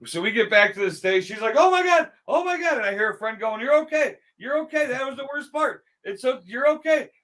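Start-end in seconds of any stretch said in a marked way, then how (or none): 1.6-2.8 much higher mock-panicked voice; imitated panic. Imitating a girl after the big drop, panicked. Retelling frightened first-time rider after drop. 3.6-7.1 high-pitched imitation of a consoling friend; imitated reassurance. Imitating a girl calming her scared friend, reassuring.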